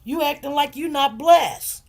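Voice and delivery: voice of the Lord scolding. Retold or imitated. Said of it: "the Lord had to pull my chain and say".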